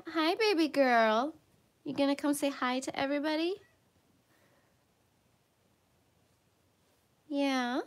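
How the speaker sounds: pet talk. Real, not acted. Greeting her pet on camera.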